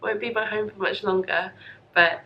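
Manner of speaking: normal talking voice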